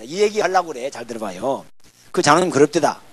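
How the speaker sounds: ordinary talk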